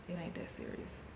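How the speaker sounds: a soft unvoiced whisper; whispered title. Shown as a demonstration. Naming the title of her video, whispered. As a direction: breathe the words like a secret title. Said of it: "the title of this video".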